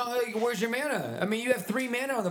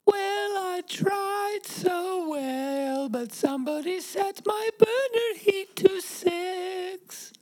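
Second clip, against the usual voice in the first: higher, slow, whining; high whiny toaster; 7 semitones higher, much slower than usual. Acted. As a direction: plead like a sad appliance. Toaster pleading.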